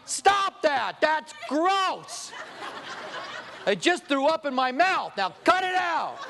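high-pitched, hammed-up disgust; disgusted scolding. Preacher scolds couple making out in back row, disgusted. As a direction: scold them in comic revulsion.

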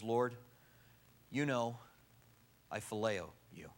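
low, halting and subdued confession; hesitant, softly spoken admission with pauses; afraid to speak boldly. Acted. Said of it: "afraid to speak too boldly now".

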